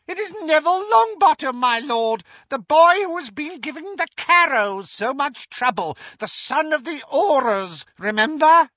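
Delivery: very high, fast, loud shrieking delight; eager servant reporting gleefully; high fast excited cackling report; gleeful Bellatrix. Acted. Bellatrix eagerly informs her lord.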